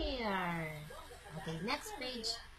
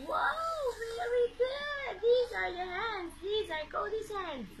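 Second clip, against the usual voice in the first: much higher, delighted praise; excited praise; 10 semitones higher, slower than usual. Acted. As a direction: cheer the toddler on.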